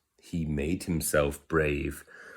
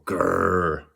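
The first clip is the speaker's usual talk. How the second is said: little mock growl.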